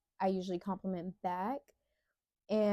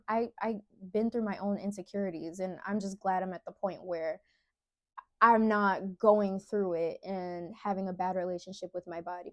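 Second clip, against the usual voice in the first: ordinary delivery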